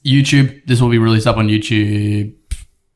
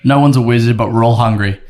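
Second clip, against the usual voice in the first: ordinary delivery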